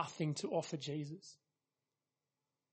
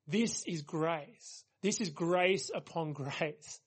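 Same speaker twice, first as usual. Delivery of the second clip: slow emphasis; slower than usual. Real, not acted. Preacher dwells on grace.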